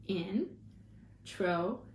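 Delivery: slow, syllable by syllable; split into syllables. Shown as a demonstration. Teacher modelling syllables for the class, slow and chopped. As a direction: chop each syllable out slowly. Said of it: "figure out how many syllables".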